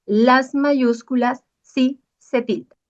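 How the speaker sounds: slow, every word weighted, sí stressed; slow emphatic rule. Shown as a demonstration. Teacher stressing to students that capitals take accents. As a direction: spell out the rule slowly for the class. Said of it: "recordemos esto".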